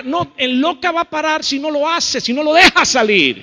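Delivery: high, loud preaching retort; forceful rebuttal. Real, not acted. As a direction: fire back hard at the objection.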